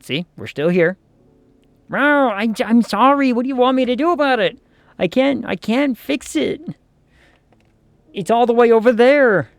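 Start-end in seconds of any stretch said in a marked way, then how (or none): 1.9-9.5 higher, fast flustered apology to a growling pet; pleading to a cat. Pretend. Streamer answering the growling cat, flustered pleading. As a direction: beg the angry cat, flustered and high.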